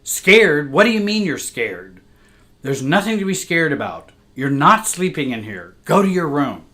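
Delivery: stern, demeaning mother. Retold or imitated. Mother scolding her frightened little girl at the bedroom door, loud and stern. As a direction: cut the child down, sharp and loud. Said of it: "in her stern and demeaning voice".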